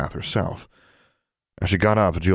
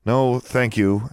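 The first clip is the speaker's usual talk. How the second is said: higher, slow, courteous refusal; polite but firm; 6 semitones higher, 3 dB louder, slower than usual. A narrator's reading. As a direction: decline politely, leaving no room for argument. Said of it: "politely but firmly".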